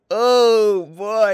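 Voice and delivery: ordinary talk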